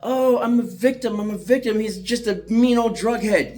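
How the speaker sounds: high, sneering falsetto mimicry of his ex; ex-wife complaining to the attorney, in a mocking high falsetto; mocking victim voice. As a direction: whine it like a fake victim playing for sympathy. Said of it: "Steph comes to you".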